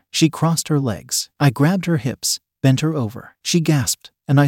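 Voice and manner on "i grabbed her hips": usual speaking voice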